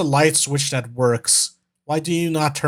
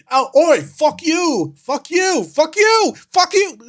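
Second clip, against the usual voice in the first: high, louder mock-British volley; bickering British guy; 11 semitones higher, 3 dB louder, slower than usual. British guy and mimic going back and forth in high mock-British yells. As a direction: trade hammed-up British yells, climbing in pitch. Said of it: "back and forth going".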